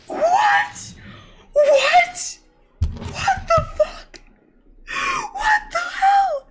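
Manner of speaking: can't believe your eyes, shout it; real shocked cries; stunned disbelief. Real, not acted. Disbelief at pull results.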